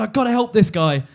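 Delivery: ordinary talk, nothing marked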